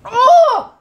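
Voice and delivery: sharp startled yelp, high and loud; surprised cry. Real, not acted. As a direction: blurt it out in shock. Reacting to sour candy.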